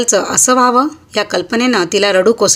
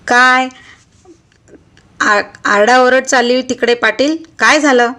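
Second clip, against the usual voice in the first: authoritative call; 3 semitones higher than usual. Acted. Officer calls out at commotion.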